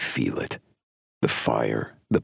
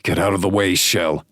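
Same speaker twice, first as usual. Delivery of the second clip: loud urgent shout; 5 semitones higher, 4 dB louder, faster than usual. A narrator's reading. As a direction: yell it as a warning. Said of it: "I shout".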